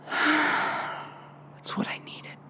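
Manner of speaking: slow, breathy whisper of release; relieved whisper. Retold or imitated. Preacher voicing David's satisfied whisper after Selah. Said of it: "He has this like".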